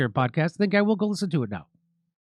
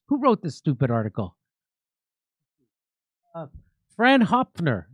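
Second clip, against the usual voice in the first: usual speaking voice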